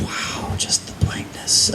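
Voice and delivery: hushed, slow whisper. Real, not acted. Reading from his book, whispering in awe. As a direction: breathe it out in awe. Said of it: "awed at the book".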